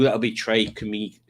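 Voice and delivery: ordinary talk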